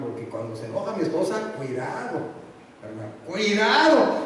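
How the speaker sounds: usual speaking voice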